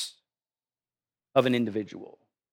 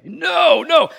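high, louder mock scream at the dog; half-hammed yell of an angry dog owner; imitated screaming; 11 semitones higher, 5 dB louder than usual. Dog owner screaming at his dog, imitated loud and high. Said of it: "he starts screaming at his dog".

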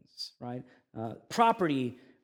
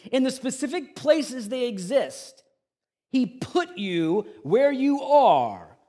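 preaching at full force; 10 semitones higher, 10 dB louder than usual. Acted. Pastor declaring God's plan to the congregation, loud preaching. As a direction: proclaim it to the whole room.

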